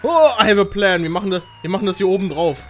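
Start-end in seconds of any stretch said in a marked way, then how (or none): none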